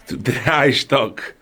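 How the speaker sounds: slow, laughing through the words. Real, not acted. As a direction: chuckle while saying it. Laughing while climbing stairs.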